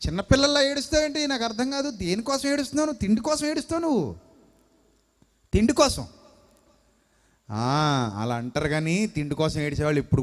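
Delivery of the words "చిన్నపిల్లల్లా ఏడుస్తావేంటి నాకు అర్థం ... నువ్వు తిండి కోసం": high-pitched, exasperated mock telling-off; acted scolding. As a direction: scold him in a raised pitch, incredulous. Acted scolding of a grown student.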